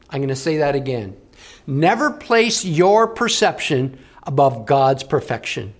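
normal talking voice

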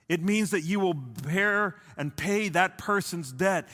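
loud, high preaching that slows into heavy emphasis; full-force preaching. Acted. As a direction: preach it out over the crowd, then lean hard on each word. Preaching at full force, then slowing.

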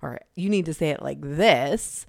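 ordinary talk, nothing marked

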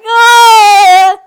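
loud, far, shocked "Oh!"; retold shocked gasp. Recalling watching the show, shocked loud "Oh!". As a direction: blurt out a shocked "Oh!". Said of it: "And I was like".

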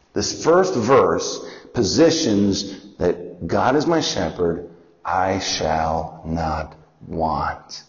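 very slow, low, deliberate reading; slow recitation. Shown as a demonstration. Teacher reciting the verse to the class, very slow. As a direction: draw each word out for memorizing. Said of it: "We're gonna learn six verses".

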